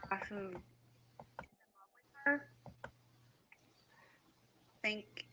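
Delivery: muffled, hand covering the microphone; finger over the mic. Real, not acted. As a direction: speak through a covered mic. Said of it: "you're covering your mic".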